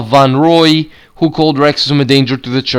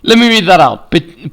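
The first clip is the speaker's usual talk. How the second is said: normal talking voice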